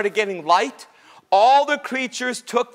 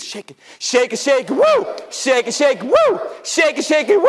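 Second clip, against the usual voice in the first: shaking branches; 3 semitones higher than usual. Acted. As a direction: chant it and leap up on "woo".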